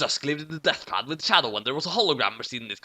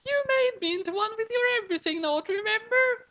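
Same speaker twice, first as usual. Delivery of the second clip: put-on much higher squeaky girl; cartoon girl voice; high falsetto girl. A narrator's reading. Bus girl speaks.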